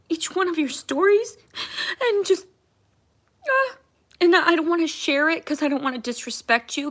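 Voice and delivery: real crying breaking through; tearful. Real, not acted. Thanking her followers, breaking into real tears.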